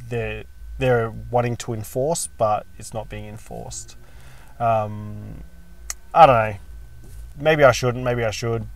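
ordinary talk, nothing marked

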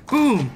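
high-pitched "boom" sound effect; mouth-made crash; imitated burst. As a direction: punch it out as an effect.

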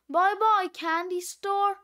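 plain reading voice

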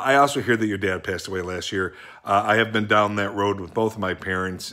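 ordinary talk

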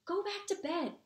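high-pitched angry order; furious. A narrator's reading. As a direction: order them off in a temper. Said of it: "said Ron furiously".

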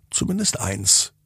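plain reading voice

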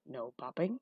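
low, soft gravelly hunchback; warning Igor. A narrator's reading. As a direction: warn with a rising question. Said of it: "he warned".

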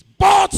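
"But" shouted high and very loud; one explosive, high-pitched "But"; shouted emphasis. Real, not acted. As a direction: burst out on "But" as the turning word. Preacher hammers the pivotal word.

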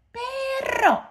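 very high puppet voice; Osito. Pretend. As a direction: squeal it like a little toy bear. (voz muy aguda de osito de juguete)

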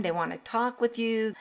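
ordinary delivery

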